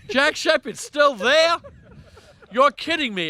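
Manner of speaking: high, slow, amazed imitation of a friend; mock astonished squeal; retold disbelief. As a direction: play up his shock that Jack is still there. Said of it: "He goes".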